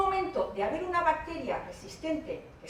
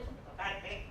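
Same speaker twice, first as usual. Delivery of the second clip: normal talking voice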